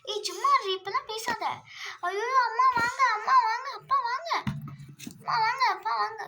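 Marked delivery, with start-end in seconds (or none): 2.1-6.2 higher, louder frantic calls; child shouting for her parents to come help, frantic. Acted. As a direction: yell for mum and dad to come. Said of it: "ஏன் கத்திக்கிட்டு இருக்க?".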